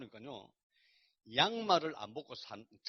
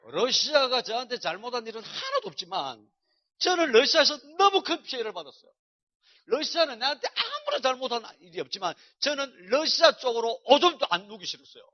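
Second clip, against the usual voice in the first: loud and higher, hammering each phrase; loud vehement outburst; 8 semitones higher, 14 dB louder than usual. Real, not acted. Preacher confessing his grudge against Russia, loud and vehement. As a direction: declare it with full force, almost shouting.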